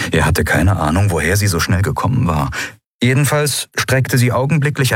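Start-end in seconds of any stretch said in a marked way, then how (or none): none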